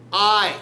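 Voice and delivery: "I" loud and drawn out; stress on I. Shown as a demonstration. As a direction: hit "I" high and hard, then break. Punching the pun on iodine.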